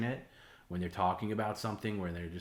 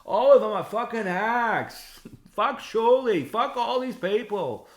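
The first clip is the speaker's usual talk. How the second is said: very high, much louder contemptuous rant; Kevin Brennan impression; 11 dB louder, slower than usual. Imitating the host trashing rivals to Bob, loud high tirade. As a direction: spit out the insults like an enraged radio host. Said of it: "that's my Kevin Brennan impression".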